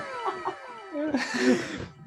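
high squealing laugh; can't hold in the laughter; burst of laughter. Real, not acted. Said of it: "I can't keep a straight face".

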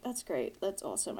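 quiet flat sarcasm; deadpan fake enthusiasm; sarcastic. Sarcastic reply while hungover.

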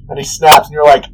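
ordinary talk, nothing marked

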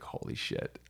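breathy stunned whisper; whispered aside. Retold or imitated. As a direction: mutter it under your breath. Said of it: "I was like".

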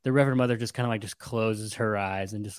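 ordinary talk, nothing marked

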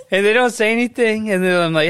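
usual speaking voice

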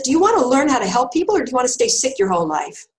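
imitating a blunt healer, loud and pushy; blunt challenge. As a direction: demand an answer, no sweetness. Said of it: "She wasn't sweet".

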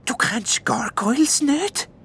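high, slow, amazed elf voice; can't believe she doesn't know; mild wonder from the elf; elf's astonishment. A narrator's reading. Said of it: "wundert sich der Elf".

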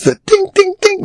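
high, ringing tink imitation; squeaky little clinks; tink noise. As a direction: imitate fingers clinking an empty jar. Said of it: "I heard the tink noise".

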